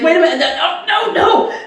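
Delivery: high, climbing mock panic; imitated flustered stumbling. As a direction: act out getting tangled up, pitch rising. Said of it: "tripping over his own".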